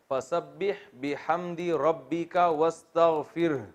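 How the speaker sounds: slow, drawn-out chanted recitation; Quranic recitation. Shown as a demonstration. Teacher recites the verse for his students, slow and chanted. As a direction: recite the verse slowly, every vowel stretched.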